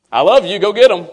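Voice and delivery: high, loud, breezy brush-off; mock dismissive send-off. Preacher mocking a careless goodbye, breezy and loud. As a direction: toss it off like a careless dad. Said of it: "I'd rather Him say".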